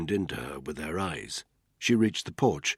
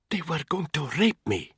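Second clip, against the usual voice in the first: breathed; 9 semitones higher than usual. Acted. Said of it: "she breathed".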